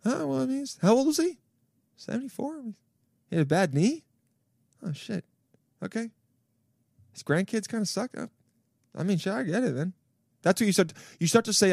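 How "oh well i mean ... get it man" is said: higher, softer, pondering voice; musing old-timer voice. Retold or imitated. An old guy weighing whether to end it. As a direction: mull it over like you're about to shrug. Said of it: "all of a sudden you go".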